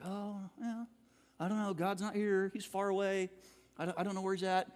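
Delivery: quiet, far-off mock doubt; mock doubting mumble. Preacher voicing a believer who feels God is gone, quietly. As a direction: softly act out someone losing faith.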